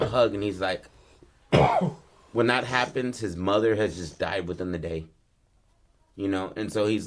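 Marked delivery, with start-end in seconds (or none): none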